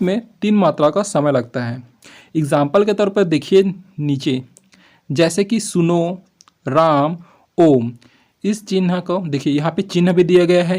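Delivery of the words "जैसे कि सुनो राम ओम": drawn-out calling vowels in सुनो, राम, ओम; calling out, prolonged. Shown as a demonstration. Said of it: "इसका प्रयोग अक्सर पुकारते समय".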